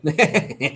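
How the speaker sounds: short nervous chuckle; nervous laugh. Real, not acted. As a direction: laugh off the nerves, briefly. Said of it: "me río de los nervios".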